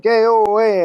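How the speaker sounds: put-on loud, high Italian-accent call; mock Italian accent. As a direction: imitate friends hamming up an Italian accent. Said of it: "Italian accents with me and be like".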